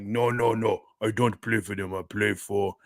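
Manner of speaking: low, accented imitation of a basketball star; deep put-on foreign accent; Joel Embiid impression. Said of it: "Dude, that's how he sound".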